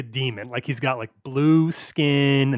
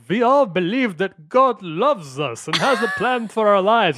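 higher, earnest mock sermon voice; imitating a devout preacher; 7 semitones higher than usual. Mocking Nightcrawler's constant preaching, earnest higher voice. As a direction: play the pious believer, pitched up. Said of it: "Nightcrawler is extremely devout".

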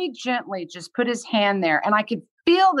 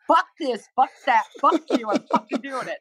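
defiant, fast, laughing swearing; warrior mode; 5 semitones higher than usual. Retold or imitated. Podcast guest acting out her inner warrior with defiant laughter. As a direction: pump yourself up, half laughing. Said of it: "get into that warrior mode".

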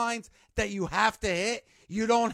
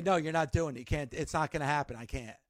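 low and softer, fading out; low and softer, trailing off to almost nothing; quiet refusal; 7 semitones lower, 7 dB quieter, faster than usual. Retold or imitated. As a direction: calmly shut it down, dropping away. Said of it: "I say".

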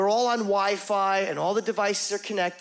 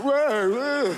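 loud, higher hammed-up whoa; overacted startle; 6 semitones higher, 3 dB louder than usual. Waking up in bed, hammed-up startle. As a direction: overact the surprise on purpose. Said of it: "I overact".

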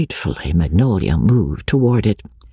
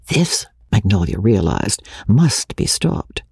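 ordinary delivery

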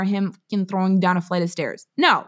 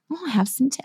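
higher-pitched mock British; British accent; 6 semitones higher, faster than usual. British-obsessed woman playing at it, mock British accent. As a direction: put on a light British accent. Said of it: "obsessed with British people".

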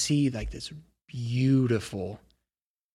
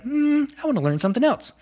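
much higher, bright and quick, a curious gnome; eager gnome voice; 6 dB louder, faster than usual. Acted. GM voicing the gnome girl keen to learn, high and quick. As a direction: pitch it way up like an eager little gnome. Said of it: "but she's like".

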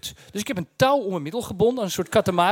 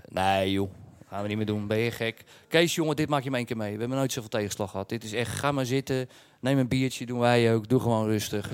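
much lower, easygoing driver voice; deep relaxed driver, lower; laid-back bus driver; 10 semitones lower, 5 dB quieter than usual. Retold or imitated. Bus drivers relaxed, offering beer.

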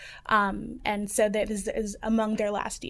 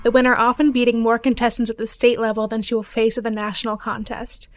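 news read; 6 dB louder than usual. Acted. Reporter reads scripted story.

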